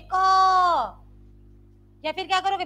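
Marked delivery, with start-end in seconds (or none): 0.0-1.0 loud, long drawn-out क. Shown as a demonstration. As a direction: belt out the syllable and hold it. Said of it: "बहुत तेज बोलोगे". (बहुत तेज़, खींचकर)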